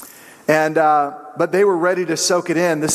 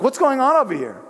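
much higher put-on voice of the returning master; the Lord surprised; 9 semitones higher than usual. Returning master finds servants asleep, high put-on voice. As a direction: ask it high, catching the servants idle. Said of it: "And the Lord's like".

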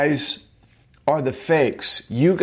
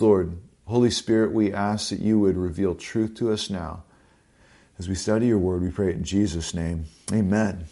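prayer; 5 semitones lower than usual. Real, not acted. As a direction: pray humbly before the sermon. Pastor leads prayer.

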